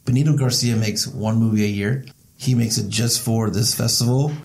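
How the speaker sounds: normal talking voice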